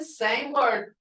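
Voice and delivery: ordinary delivery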